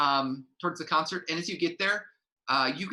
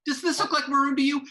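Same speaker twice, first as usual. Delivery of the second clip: much higher, louder, fast; snapping yell; 12 semitones higher, 5 dB louder, faster than usual. Acted. Stage manager losing patience with a guard, loud. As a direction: blow up and fire it off.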